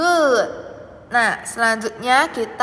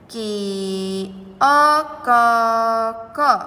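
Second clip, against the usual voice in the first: over enunciating. Shown as a demonstration. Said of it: "kita tulis ki ya".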